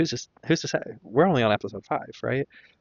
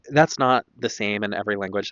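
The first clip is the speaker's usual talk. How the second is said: normal talking voice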